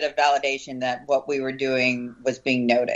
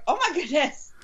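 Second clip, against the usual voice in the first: high, breathless oh my goodness; mock amazement, voice jumping up; amazed gasp; 13 semitones higher than usual. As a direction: gasp it out in disbelief. Retold amazed reaction.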